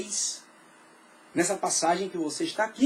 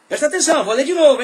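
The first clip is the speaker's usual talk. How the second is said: loud, higher call to the room; calling for attention; 5 semitones higher, 10 dB louder, faster than usual. Real, not acted. Pastor calling the church to listen, loud. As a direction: demand the congregation's attention.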